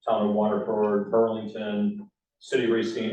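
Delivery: ordinary talk, nothing marked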